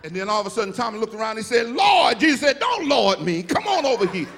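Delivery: shouting, high, preacher's climax with Jesus's voice rising; full-force preaching. Acted. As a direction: build it to a roaring, sung-out whoop.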